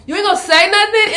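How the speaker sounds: high, loud, indignant question; high loud incredulous retort; indignant disbelief. Retold or imitated. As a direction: demand an answer, outraged. Driver ignores her.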